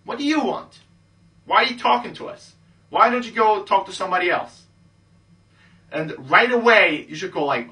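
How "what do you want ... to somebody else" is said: put-on high, loud, arrogant girl talking down; bitchy and arrogant. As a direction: play the stuck-up girl, pitched high and loud. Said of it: "kinda be bitchy and arrogant and say".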